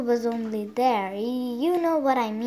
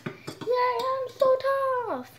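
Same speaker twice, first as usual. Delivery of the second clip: 1 high toy dinosaur; 12 semitones higher than usual. Pretend. Voices the triceratops.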